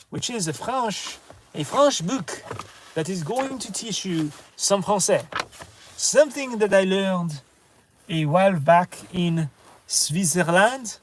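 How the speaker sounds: English with a hammed-up French accent; jokey French-accented English, lightly done; mock French accent. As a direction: lean into a cheesy French accent on English words. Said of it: "stop with the French English accent, which is pretty terrible".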